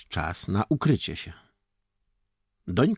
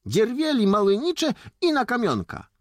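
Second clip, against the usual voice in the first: put-on insistent village boy; 6 dB louder than usual. Boy continues route.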